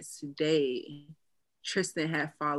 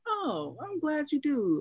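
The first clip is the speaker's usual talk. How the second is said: higher and slow, sugary; sweetly drawn-out thanks; playing it gracious for the fan; mock sweet reply; 11 semitones higher, slower than usual. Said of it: "And I'm like".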